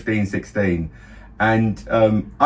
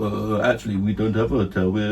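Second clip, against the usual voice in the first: awkward mumble; 4 semitones lower, 5 dB quieter than usual. Retold or imitated. Imagined awkward reply as fake tourists.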